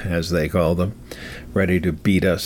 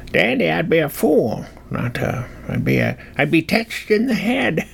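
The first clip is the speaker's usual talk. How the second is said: put-on high-pitched rustic old-timer voice; folksy old-timer; 7 semitones higher than usual. Old-timer tempted by a gift jet, folksy and high. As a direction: rattle it off like a tickled backwoods codger.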